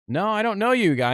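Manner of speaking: half-hammed nervous denial; mock flustered denial. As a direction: deny it in a panicked high pitch. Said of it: "usually I'm like".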